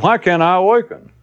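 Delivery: high, plaintive question; mock seeker's lament; inner voice, complaining. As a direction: plead it pitched well up. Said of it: "The voice said".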